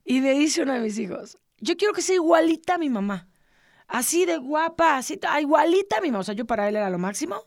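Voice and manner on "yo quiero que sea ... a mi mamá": put-on higher, excited little boy's voice, with the middle phrase slowed; playfully voicing her young son's adoring words; imitating her son. Retelling her son's Disney remark, higher and excited like a child.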